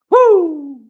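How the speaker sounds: loud, high-pitched "woo"; high whoop. Pretend. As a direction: let out a playful high whoop. Whoop amid viral clip imitations.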